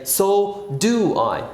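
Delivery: slow, careful model line; teacher models the reply slowly; slow model answer. Shown as a demonstration. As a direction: drill it slowly for the class. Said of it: "So you say".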